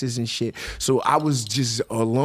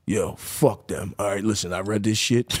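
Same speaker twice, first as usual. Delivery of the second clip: imitating his lawyer; 6 semitones lower, slower than usual. Showing his friends how his lawyer talks, a low put-on lawyer voice. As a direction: play the brash lawyer mocking the charges. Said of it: "This is my lawyer right here".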